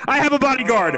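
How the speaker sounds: high-pitched, hammed-up elation; mock triumph. Host boasts of his new bodyguard, mock triumphant. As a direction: crow it excitedly, playing up the thrill.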